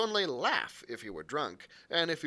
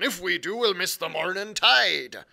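loud pirate cry; 4 semitones higher, 10 dB louder than usual. Acted. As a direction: call it out across the deck. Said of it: "cried Long John".

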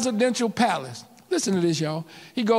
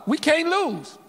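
rising proclamation; 7 semitones higher, slower than usual. Real, not acted. Sermon climax, preacher lifts voice.

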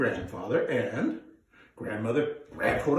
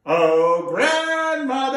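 louder, higher slow call; 11 semitones higher, 9 dB louder, much slower than usual. Acted. Grandfather calling his wife. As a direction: call across the field. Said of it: "I don't think you said it loud enough".

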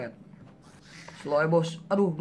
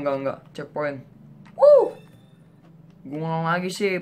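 usual speaking voice

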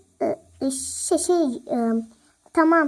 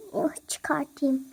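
whisper; 4 semitones lower, 6 dB quieter, slower than usual. Acted. Sneaking gum out, whispering.

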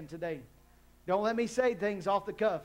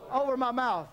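congregation recital; 7 semitones higher, slower than usual. Shown as a demonstration. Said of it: "On the count of three".

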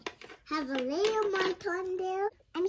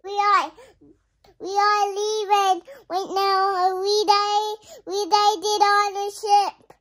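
loud and higher, calling everyone aboard; shouting; 4 semitones higher, 12 dB louder than usual. Pretend. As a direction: yell it across the deck. Child shouting to board ship.

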